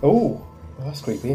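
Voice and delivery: normal talking voice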